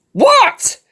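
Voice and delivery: very high, loud "what"; startled high shout; exasperated yell back. A narrator's reading. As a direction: snap back at the top of your voice. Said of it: "Perry finally answered back".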